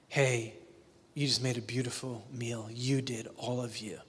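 soft, low, sincere thanks; quietly honour the whole person; tender praise. Pretend. To the daughter who cooked, soft sincere thanks. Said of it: "What did I say?".

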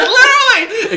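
very high, loud squeal of agreement; laughing shriek. Real, not acted. Storyteller agreeing with friend's joke, laughing shriek.

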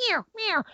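high, brief imitated cow mews; short cow-call mews. Shown as a demonstration. Hunter shows the wrong cow call, short high mews. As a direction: mew twice, short and high. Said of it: "I'm not going".

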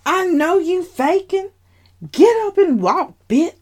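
high, loud, taunting sass; mocking sister. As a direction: jeer at her sister for faking. Sister mocking in hospital room.

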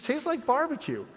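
put-on high little kid voice; light mock toddler. Toddler line repeated.

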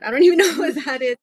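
loud, high, quick mock bafflement; baffled retort. Retelling her reply to the born-again caller, baffled.

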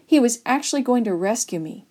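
ordinary delivery, nothing marked

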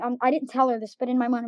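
ordinary talk

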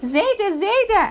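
put-on high childlike voice calling grandpa; a small boy's sweet high call; little grandson calling. Small grandson tugging grandfather's beard, high childlike voice. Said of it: "and he says".

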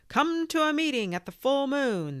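high, loud call across the caves; yelling. A narrator's reading. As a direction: shout it out to everyone far away. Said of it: "they yelled".

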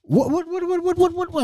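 high, repeated stammer of shock; stuck on one word in disbelief; comic high-pitched stutter; flustered stuttering. Retold or imitated. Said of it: "I'm stuttering".

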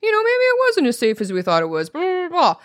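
a hint of a sing-song mock, jumping high; mocking the media. Mocking the media backtracking, lightly sing-song. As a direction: lightly parody the backpedalling news voice. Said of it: "started coming out saying".

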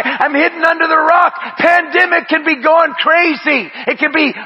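loud, high, driving sermon cry; fiery pulpit shout; full-force preaching. Acted. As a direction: preach it out hard over the music.

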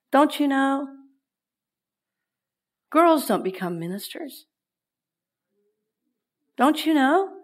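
taunting don't you know, nagging; belittling inner voice; ego voice. Retold or imitated. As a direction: sneer like your inner critic. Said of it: "That's that ego voice".

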